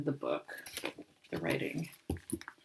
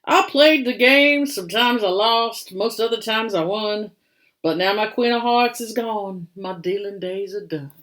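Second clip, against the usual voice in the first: put-on louder, rueful old gambler; card sharp bison; 4 semitones higher, 8 dB louder than usual. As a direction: tell of lost luck with a gambler's swagger fading. Bill Bison speaks in story.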